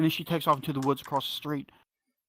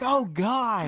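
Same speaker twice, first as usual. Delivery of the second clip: disgusted groan; 8 semitones higher, 4 dB louder than usual. Retold or imitated. Recoiling at flashing.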